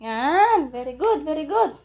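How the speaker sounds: loud, high praise for a pet; excited praise. Real, not acted. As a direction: cheer the pet on. Praising pet after it climbs.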